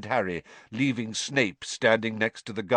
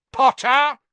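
loud, high shout of a name; commanding yell down a corridor; sharp call; 10 dB louder than usual. A narrator's reading. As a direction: call someone to a halt. Snape calls Harry to halt.

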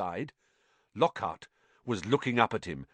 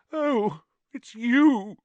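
Myrtle; 5 dB louder, much slower than usual. Acted. Myrtle voiced in story.

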